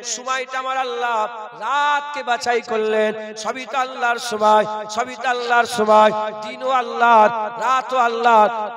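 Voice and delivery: loud, drawn-out sermon delivery; loud Bengali waz preaching; preaching at full force. Acted. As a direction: preach it to the whole gathering.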